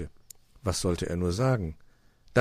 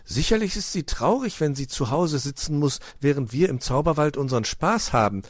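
lightly voiced child, raised pitch; earnest, concerned child's plea; concerned little fox; 8 semitones higher, 6 dB louder than usual. A narrator's reading. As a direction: start delighted, then turn mildly worried. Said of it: "meinte Weißohr besorgt".

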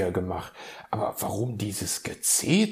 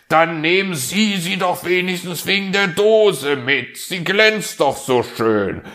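higher, louder call after someone leaving; calling after her; 10 semitones higher, 9 dB louder than usual. Acted. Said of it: "rief er ihr nach". (Fuchs ruft der Elster laut nach)

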